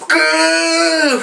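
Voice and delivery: loud high muffled yell; falling yell. Retold or imitated. Landing in the snow, a loud high yell. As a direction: cry out into the snow. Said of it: "I'm, I'm going".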